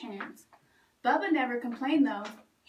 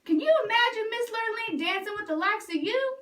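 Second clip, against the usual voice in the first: higher, scornful ridicule; mocking taunt; 8 semitones higher than usual. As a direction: laugh the idea off with scorn. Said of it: "said Milton".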